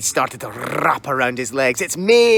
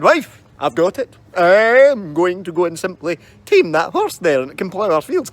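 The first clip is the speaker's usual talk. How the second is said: louder, cocky husband voice; boastful husband; 3 semitones higher, 6 dB louder than usual. Acted. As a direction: announce it like a foolish husband.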